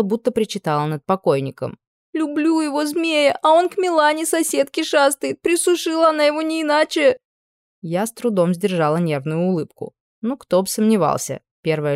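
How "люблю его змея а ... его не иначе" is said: high, lamenting wail; keen like mourning over the dead; wailing girl. Acted. Jilted teen girl wailing to the witch about her boyfriend. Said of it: "громко взвыла".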